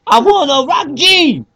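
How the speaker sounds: high, loud rock-frontman voice, slowing on Hit it, Gene; [name] impression. Co-host does a rock star calling to his bandmate on stage. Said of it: "that's my [name] impression".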